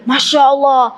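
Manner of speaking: ordinary talk, nothing marked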